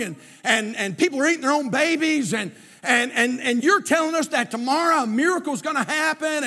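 high, loud preacher acting out a doubter; mock outraged objection in preaching voice; incredulous protest. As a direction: argue back in heated disbelief, piling it on.

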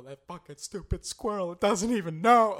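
put-on retelling the girlfriend losing it, loud; freaking out. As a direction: build to a frantic yell. Girlfriend freaking out over squirrel.

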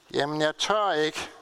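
ordinary talk, nothing marked

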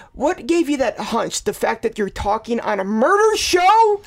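rising disbelief that peaks on the last words; incredulous retort. Retold or imitated. Host heckling Stacy's hunch on the show, incredulous retort.

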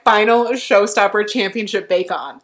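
loud mock solemn declaration; hammed-up contestant boast; quoting Tamal. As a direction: announce it like a grand plan. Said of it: "he was like".

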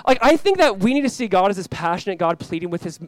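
ordinary talk, nothing marked

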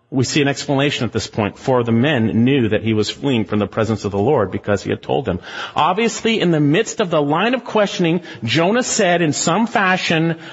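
ordinary delivery

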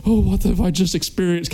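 a hammed-up horrified gasp of a line; mock terror. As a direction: lightly play someone shaken to the core. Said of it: "Kinda like terror".

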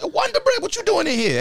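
high, loud mock greeting; imitating a friend's loud, high-pitched call-out; retold calling out. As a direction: act out hollering a nickname across the room. Acting out friend calling him out.

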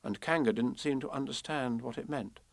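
usual speaking voice

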